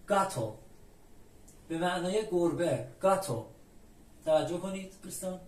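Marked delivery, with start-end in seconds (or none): 0.1-0.6 گاتو with a hard g at the start. Shown as a demonstration. 3.0-3.5 گاتو with a hard g at the start. Shown as a demonstration.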